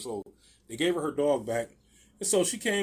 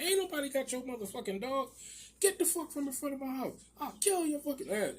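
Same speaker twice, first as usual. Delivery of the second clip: high, softer-voiced imitation of an irate woman; mock angry threat; 10 semitones higher, 6 dB quieter than usual. Wife ordering the neighbour off the property, mock angry. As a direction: play her fury high without shouting.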